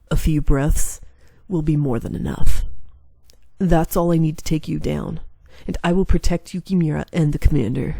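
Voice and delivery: lightly voiced loyal bodyguard standing firm; resolute warrior. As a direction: answer the threat with calm resolve. Yamazaki answers the taunt.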